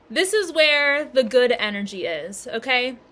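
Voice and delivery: normal talking voice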